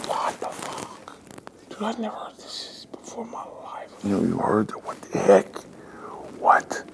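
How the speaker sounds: hushed, stunned whisper, slowed down; shocked whisper. Retold or imitated. At the table, whispering in disbelief. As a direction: whisper your disbelief to yourself.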